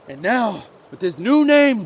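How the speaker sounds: ordinary delivery, nothing marked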